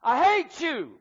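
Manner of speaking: put-on high, loud little boy's shout; shouted outburst, high and hard; angry yell. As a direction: yell it out across a mountainside. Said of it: "he just yelled out".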